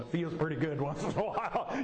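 chuckling through the words; amused confession. Real, not acted. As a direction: confess it with a guilty laugh. Chuckles admitting he enjoyed firing people.

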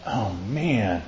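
low, soft, downcast mutter; depressed groan. Acted. Minister voices people stuck in depression, low and downcast. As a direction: let it sink quietly, weighed down. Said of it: "in a situation of depression".

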